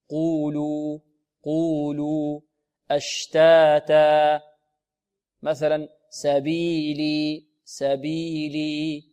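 slow, level chant with equal long vowels in قولوا, أشتاتا, سبيلي; مد طبيعي. Shown as a demonstration. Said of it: "هكذا يضبط المد الطبيعي". (قولوا وأشتاتا وسبيلي بمدود متساوية)

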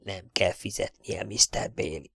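hushed, hoarse stage whisper. Acted. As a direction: whisper it furtively, throat tight. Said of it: "majd rekett hangon suttogta".

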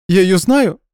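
put-on tearful girl. Hurt girlfriend demanding answers, high tearful. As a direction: press him for an answer. Nadya questioning.

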